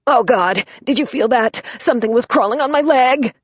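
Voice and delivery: loud, high panicked squeal. Acted. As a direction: freak out about the mouse. Panicked by crawling mouse.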